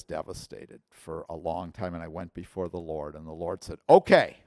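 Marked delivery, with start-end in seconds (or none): 3.9-4.4 loud, high, emphatic retort; stern exclamation. Retold or imitated. The Lord's stern reply acted out.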